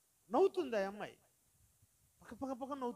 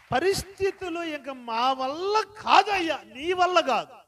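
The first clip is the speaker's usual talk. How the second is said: loud, slow, ringing preacher's voice; preaching at full force; 15 dB louder, slower than usual. Acted. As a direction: drive every word home to the congregation. Pastor preaches on.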